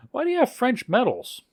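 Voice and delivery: high, baffled question; puzzled questioning. Retold or imitated. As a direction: act out baffled curiosity, pitched high. Journalist questions the elevator operator.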